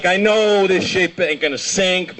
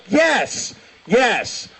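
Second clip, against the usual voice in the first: delighted approval; 5 semitones higher than usual. Retold or imitated. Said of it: "I was just like".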